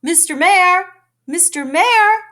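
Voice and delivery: high loud call across distance. A narrator's reading. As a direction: call out to someone far off. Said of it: "Horton called".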